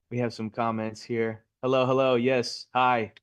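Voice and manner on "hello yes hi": normal talking voice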